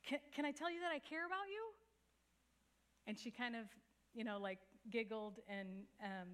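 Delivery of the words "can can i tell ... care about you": yelling back. Retold or imitated. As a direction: blurt it back across the room quickly. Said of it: "I yelled back".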